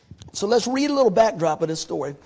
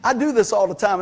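normal talking voice

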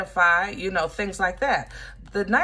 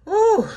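whoop; 13 semitones higher, 8 dB louder than usual. Real, not acted. Recovering from laughing fit.

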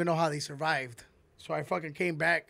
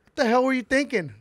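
higher, louder stern cop demanding answers; angry trooper; 7 semitones higher, 7 dB louder than usual. Retold or imitated. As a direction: scold him like a mad trooper. Trooper scolding him.

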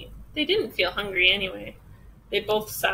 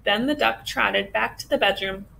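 ordinary delivery

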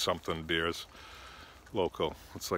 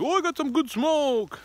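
much higher, louder put-on hustler voice; pot-selling airport guy; 6 dB louder than usual. Airport worker insisting on selling weed, high mock voice. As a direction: sell it with a grin in the voice. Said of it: "He's like".

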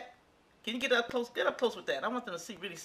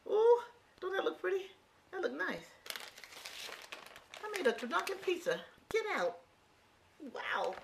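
thrilled surprise; 10 semitones higher, 4 dB quieter than usual. Real, not acted. Seeing her finished pizza.

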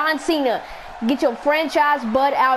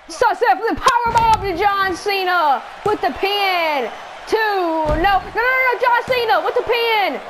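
excited shouting; 7 semitones higher than usual. Real, not acted. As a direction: shout the near fall live. Excited play-by-play of a near fall.

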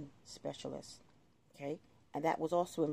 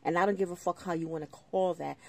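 ordinary talk, nothing marked

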